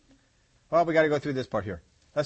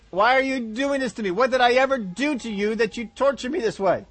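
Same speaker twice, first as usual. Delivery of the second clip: higher mock-wounded whine; a hammed-up put-upon body; complaining body; 7 semitones higher than usual. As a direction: play the body as a martyr. Body complaining about exercise.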